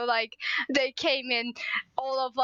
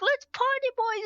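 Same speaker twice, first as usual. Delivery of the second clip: retold party shout; 9 semitones higher than usual. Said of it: "everybody started screaming".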